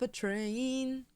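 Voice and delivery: ordinary talk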